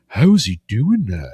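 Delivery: drawn-out mock bafflement; incredulous imitation. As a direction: imitate a slow-witted amazed reactor. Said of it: "The incredulous imbeciles".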